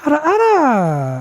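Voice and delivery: high, slow hammed-up ara ara; mock ara ara. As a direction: stretch it out in a bad anime voice. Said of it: "That was terrible".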